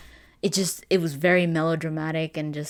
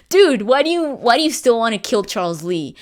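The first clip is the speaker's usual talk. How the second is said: exasperated laugh; 6 semitones higher, 8 dB louder than usual. Real, not acted. Mocking game's plot choice.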